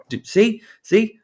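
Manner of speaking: high, loud triumphant see; excited. Real, not acted. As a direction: gloat that you were right. Triumphant, vindicated.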